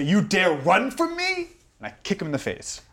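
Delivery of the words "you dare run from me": slow, louder and higher, dangerous; menacing threat. Acted. Player's noble snarling at the fleeing servant. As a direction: loom over him and demand it. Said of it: "and I say".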